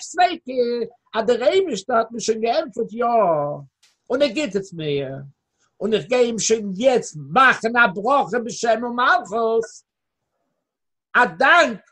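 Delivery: normal talking voice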